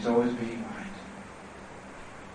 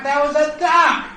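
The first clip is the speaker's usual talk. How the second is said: high, loud insistence on the last word; heated insisting; 13 semitones higher, 6 dB louder than usual. Acted. Husband contradicting his wife about the duck, heated insisting. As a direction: argue back with rising volume. Couple's quarrel acted out.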